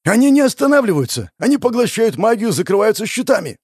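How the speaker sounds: high, loud frantic report; panicked shout. Acted. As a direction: yell it in rising panic.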